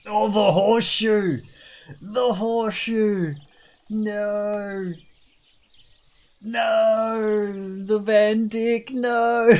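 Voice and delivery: loud, high, drawn-out protest; mock dismay. Player protests chat's horseshoe pick, hammed-up dismay.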